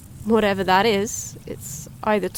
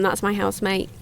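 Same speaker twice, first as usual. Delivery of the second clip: usual speaking voice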